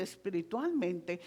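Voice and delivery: ordinary talk, nothing marked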